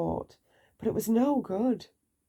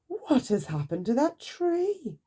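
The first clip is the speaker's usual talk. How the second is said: astonished cry; 7 semitones higher than usual. A narrator's reading. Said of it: "he cried".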